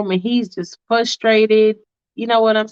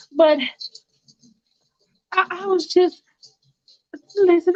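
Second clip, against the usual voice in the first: much higher voice, desperate pleading about Katie; Faith pleading; 9 semitones higher than usual. Retold or imitated. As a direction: pitch it way up, begging him to understand. Faith justifies burning the church.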